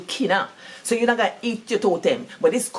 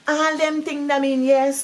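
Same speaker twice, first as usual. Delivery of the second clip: usual speaking voice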